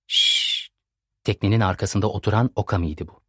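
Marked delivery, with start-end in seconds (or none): 0.1-0.7 sharp unvoiced shush. Okami hushes the group.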